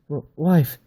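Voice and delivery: high broken stammer of fear; the tied-up wife answers, terrified; frightened cry. Acted. Tied-up wife answers the beast in a frightened cry. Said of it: "she cried".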